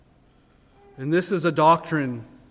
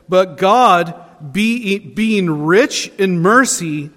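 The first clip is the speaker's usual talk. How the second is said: louder, measured Bible reading; scripture reading; 5 dB louder, slower than usual. Acted. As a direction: proclaim the verse slowly and firmly. Reads scripture aloud louder.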